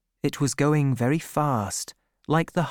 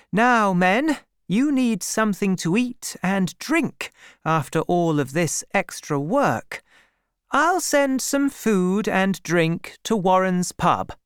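higher-pitched woman addressing her workers; lady farmer, commanding; 7 semitones higher than usual. A narrator's reading. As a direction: call the men to attention as their employer. Voices Bathsheba addressing her workers.

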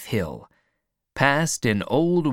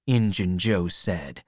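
plain reading voice

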